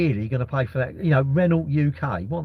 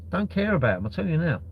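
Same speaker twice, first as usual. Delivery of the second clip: ordinary talk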